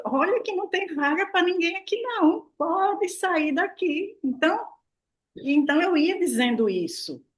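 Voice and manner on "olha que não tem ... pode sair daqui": telling her cells there is no room, commanding; firm eviction. Retold or imitated. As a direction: order the illness out. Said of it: "eu faço desse jeito".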